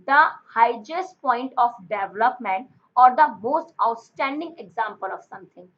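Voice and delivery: reading the definition out word by word, very slowly; slow dictation. Shown as a demonstration. Teacher dictating the English meaning to students, very slow and deliberate.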